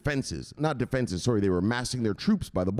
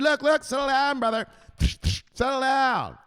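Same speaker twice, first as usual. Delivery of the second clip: hammed-up calming call; 4 dB louder than usual. [name] calming Zelensky.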